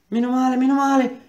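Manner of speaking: esclamazione acuta e forte, ripetuta; sollievo agitato. A narrator's reading. Ingegnere ripete sollevato, narrato. (sollievo esclamato ad alta voce)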